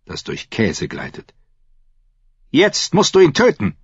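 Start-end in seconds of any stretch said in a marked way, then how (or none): 2.5-3.8 high, loud stern demand; urgent command. A narrator's reading. Priest uncle demands the prisoner's death, commanding loudly. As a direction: order it forcefully, voice raised high.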